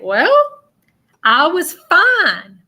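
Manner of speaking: acted insistence on being fine. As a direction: slowly wave away health worries in her voice. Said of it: "the way that she's making it seem".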